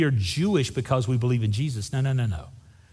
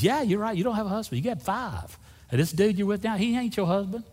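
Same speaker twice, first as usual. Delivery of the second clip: gentle truth-telling; 7 semitones higher than usual. Acted. Jesus gently naming her situation.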